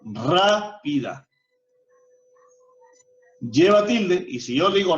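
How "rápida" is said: Rápida drawn out slowly, stress on RÁ; slow teacher's demo of Rápida, RÁ held long; esdrújula stress. Shown as a demonstration. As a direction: stretch the first syllable of Rápida, then let pida drop. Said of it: "Es una palabra esdrújula".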